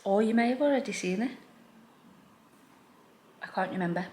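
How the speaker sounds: usual speaking voice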